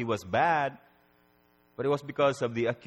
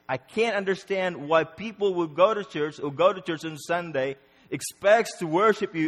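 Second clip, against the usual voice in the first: raised, impassioned pleading with God; anguished lament; 5 semitones higher, 4 dB louder than usual. Acted. Believer questioning God over tragedies, anguished plea. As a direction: cry out in bewilderment, pitch pushed up.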